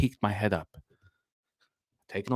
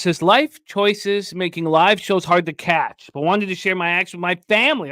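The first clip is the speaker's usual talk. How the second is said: hyped bet read; 5 semitones higher than usual. Acted. Host reading a viewer's parlay, hyped announcer voice. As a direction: sell each parlay like a ring announcer.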